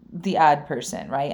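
normal talking voice

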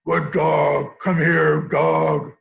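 gruff man commanding his dog, loud; loud, low, gravelly dog command; deep, gravelly voice. Retold or imitated. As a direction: imitate a deep-voiced owner calling the dog. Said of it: "speaking in a deep, gravelly voice".

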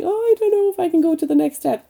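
high, fast fretful voice; fretting, quick and high; anxious whine. Retold or imitated. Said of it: "standing on the stone going".

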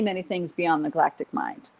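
usual speaking voice

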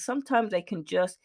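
ordinary talk

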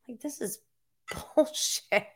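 under-breath aside. Real, not acted. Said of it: "aside under breath".

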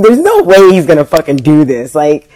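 retold outraged thought; indignant disbelief. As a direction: protest it loudly in disbelief.

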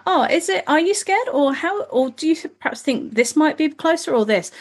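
put-on high, gentle questioning to a small child; mom coaxing a child. Mom asking her young child how the feeling seems, high and gentle. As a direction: coax the little one to name the feeling.

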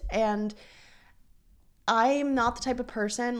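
ordinary delivery

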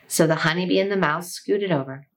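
plain reading voice

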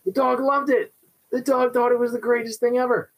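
bubbling, high voice of a delighted dog. Retold or imitated. Trainer voicing a thrilled dog's joy, excited. Dog loving the new reward.

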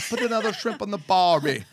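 high, hammed-up Australian accent. Shown as a demonstration. Warming up before reading a news clipping, mock Australian accent. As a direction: try out a cartoonish Australian twang, amused. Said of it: "in my best Australian accent".

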